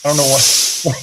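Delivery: hammed-up Italian accent, playful impersonation. Northerner asking if he sounds like Elvis, Italian accent. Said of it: "I did an Italian accent".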